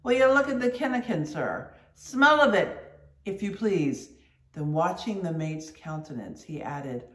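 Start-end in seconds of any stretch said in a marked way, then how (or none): 0.0-4.1 loud, fast, outraged appeal; mate appealing to his superior, outraged; indignant complaint. Acted. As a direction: demand the mate smell it. Stubb complaining to Starbuck.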